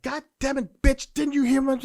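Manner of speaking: loud high angry yell. Retold or imitated. Man raising his voice at a woman, angry yell. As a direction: bark it out at her, full volume. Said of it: "if I rose my voice like".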